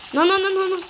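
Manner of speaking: high, loud, rapid nos; panicked shout. Real, not acted. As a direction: cry out no in panic. Panicked no in game.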